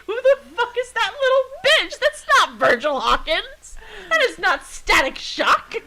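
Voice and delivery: high, loud, indignant yell; outraged shout. Retold or imitated. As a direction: shriek it in disbelief. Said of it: "And I'm like".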